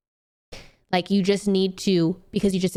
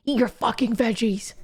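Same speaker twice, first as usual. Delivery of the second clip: a hint of a scolding mom; violent mom; 2 semitones higher than usual. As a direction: bark it like a rough mom. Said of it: "Mom is kind of violent".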